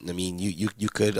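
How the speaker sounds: ordinary talk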